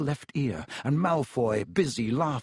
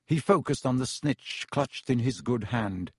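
ordinary delivery, nothing marked

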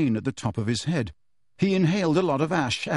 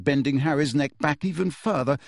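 usual speaking voice

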